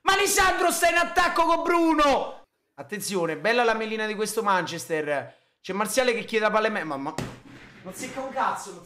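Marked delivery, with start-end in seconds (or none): 0.0-2.4 excited shout. Real, not acted. Yelling at player on TV.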